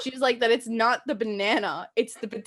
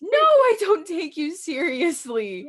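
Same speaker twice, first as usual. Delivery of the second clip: mock teasing; 7 semitones higher, 5 dB louder, slower than usual. Teasing her friend.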